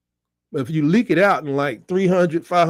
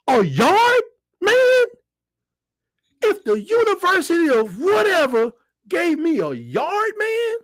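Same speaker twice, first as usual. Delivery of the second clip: high, cracking near-tears excitement; the dream gift is too much; overwhelmed; 11 semitones higher, slower than usual. Real, not acted. Overwhelmed by yard man dream.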